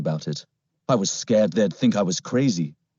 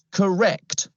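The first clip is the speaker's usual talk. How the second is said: higher-pitched character voice for an officer; put-on policeman voice; 10 semitones higher than usual. Policeman explains the problem to the family, put-on officer voice.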